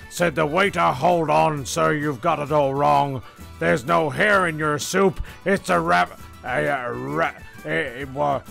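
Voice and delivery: ordinary talk, nothing marked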